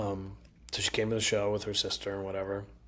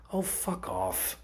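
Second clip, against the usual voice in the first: slow, drawn-out retold brush-off; cutting dismissal; 2 semitones lower, much slower than usual. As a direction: imitate her stopping someone dead. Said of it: "she stopped me dead in my sentence".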